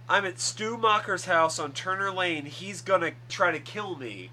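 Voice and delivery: mock distress call, still fairly controlled; retold call for help. Retelling Sidney's radio call for help.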